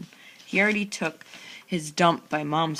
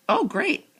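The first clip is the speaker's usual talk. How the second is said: "Oh, great" high, louder and delighted; pleased realization; 12 semitones higher, 5 dB louder than usual. A narrator's reading. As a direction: brighten up at a lucky delay. Then, glad the lecture delays woods.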